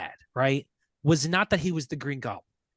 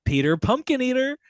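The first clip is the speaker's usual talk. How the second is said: high teasing nursery-rhyme lilt; singsong taunt; 10 semitones higher, 4 dB louder than usual. Retold or imitated. Handcuffed Harry mocking Peter as he passes, high and singsong. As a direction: sing it up high to needle him. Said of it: "he goes".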